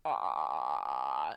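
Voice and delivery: very low, drawn-out mock bellow; playful deer bellow imitation. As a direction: groan low like a rutting stag. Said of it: "This is how I bellow to females".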